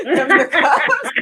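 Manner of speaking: words squeezed out through laughter, slowed; laughing at her own punchline; real laugh breaking the words. Real, not acted.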